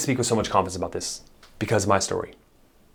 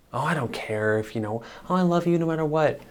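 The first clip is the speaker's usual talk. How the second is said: mock wife reassuring; 6 semitones higher, 4 dB quieter than usual. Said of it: "She'll say she doesn't care".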